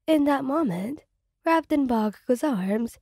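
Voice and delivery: ordinary delivery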